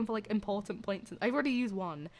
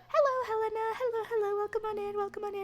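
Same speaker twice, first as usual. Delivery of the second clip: much higher, sing-song welcome host; high greeter voice; 12 semitones higher than usual. Acted. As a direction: greet each newcomer in a bright high put-on voice. Greeting viewers in put-on voice.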